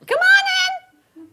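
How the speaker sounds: slow, very high, loud singsong; cheery calling out. Acted. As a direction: stretch it into a piercing welcome. New mother calls through door.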